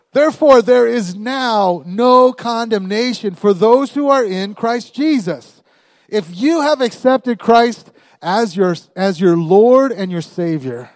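loud, high, slow proclamation; full-force preaching. Real, not acted. Preacher declaring the verse to congregation, full-force proclamation. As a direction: thunder it out to the room, drawing out key words.

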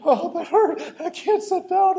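put-on a kid faking hurt so mom hears; hammed-up fake pain. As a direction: oversell a fake spanking. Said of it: "You're selling it a little too much".

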